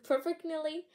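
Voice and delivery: giggling through the word after stumbling; laughing. Real, not acted. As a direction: laugh as you get the word out.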